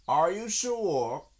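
high, slow doubting question; high astonished creature voice; surprised rabbit. A narrator's reading. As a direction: ask in wondering disbelief. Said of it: "still more surprised".

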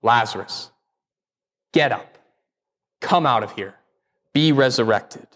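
a tender, intimate summons to rise; calling by name. Retold or imitated. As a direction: gently call a friend by name. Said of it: "because he calls them by name".

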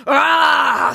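loud startling troll cry; troll roar. Acted. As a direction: jump out at him roaring. Troll leaps out again.

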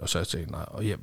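normal talking voice

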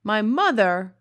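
a giant shocked by the question; cried out. A narrator's reading. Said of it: "cried the B F G".

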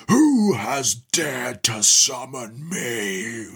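slow, drawn-out demon voice, lightly done; mock demon roar. Demon demanding who summoned him, mock slow roar. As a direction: demon lord booming out, only a hint of a roar. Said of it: "he roars".

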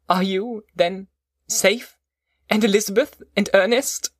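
high-pitched, hopeful questioning; anxious cry. A narrator's reading. Son greeting father, anxiously asking after his family. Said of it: "stretched out my hand to him and cried".

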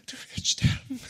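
high, breathy comic whisper; weakened mock whisper. Defeated voice in her head, feeble high whisper. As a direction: imitate a beaten voice reduced to whispering. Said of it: "Bis die Stimme nur noch flüstern".

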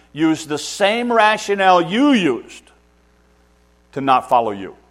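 loud, high, driving sermon; preacher warning parents, loud and high; full-force preaching. Acted. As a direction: pound the point home from the pulpit.

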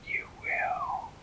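an ancient tree speaking inside her head; eerie tree whisper. A narrator's reading. The old tree calls the fairy by her new name, whispering.

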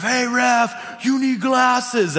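high yelled heckle from the stands; retold heckle at ref. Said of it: "holler out this thing at the ref".

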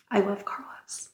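soft unvoiced whisper. Real, not acted. Fan whispering her love for Carlos, soft whisper. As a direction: breathe it out like a secret. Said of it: "whispered aside".

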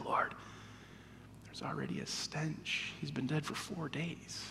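very soft, low protest; hushed reluctant warning. A narrator's reading. Martha warning Jesus at the tomb, hushed. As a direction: murmur the objection under the breath.

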